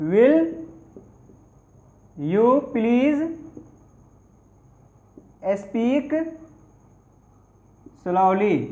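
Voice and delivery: "Will", "you please", "speak", "slowly" said with long pauses between; word by word. Shown as a demonstration. Teacher dictating to students, slow word by word. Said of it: "क्या कहिएगा?".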